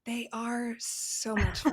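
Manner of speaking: slow conspiratorial whisper. Pretend. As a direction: whisper it back like a secret. Whispered agreement.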